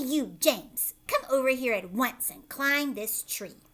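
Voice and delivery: put-on loud commanding order to the boy; bossy aunt. Aunt Sponge ordering James to climb the tree.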